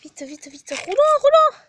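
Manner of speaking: démarre bas puis bondit dans l'aigu; panique comique. Acted. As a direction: se presser de se cacher, affolée. Se cache en vitesse, paniquée. (panique jouée, qui monte en cri aigu)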